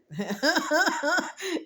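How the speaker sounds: bright high burst of laughter. Real, not acted. Vlogger laughs at her own remark, high real laugh. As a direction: laugh out loud at your own words.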